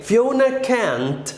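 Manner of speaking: slow, careful model phrase; teacher modelling slowly; slow model sentence. Shown as a demonstration. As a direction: say it slowly for the class. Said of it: "we say".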